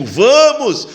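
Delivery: rally them forward with a sudden high call; urgent call. Acted. Jesus urging the listener forward, a high rallying call.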